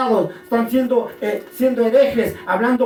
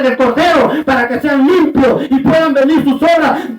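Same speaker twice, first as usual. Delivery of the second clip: loud, driving revival preacher; preaching at full force; 3 semitones higher, 5 dB louder than usual. Real, not acted. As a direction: build to a forceful, raised-pitch warning. (prédica enérgica a toda voz)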